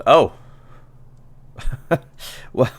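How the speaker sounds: mildly flustered, with a little laugh first; awkward laugh, then a polite thanks trailing down; embarrassed. Acted. Embarrassed speaker thanks the visitors for catching the murderer, mildly flustered. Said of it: "And she's visibly embarrassed".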